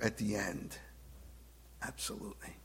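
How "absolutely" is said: breathy whisper, very soft; whispered absolutely. Acted. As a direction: lean in and whisper the answer. Answers own question, whispered.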